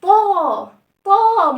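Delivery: طاء said loud with a wide open long aa. Shown as a demonstration. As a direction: stretch طاء wide open, full mouth. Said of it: "والصوت بتاعهم كان عامل ازاي".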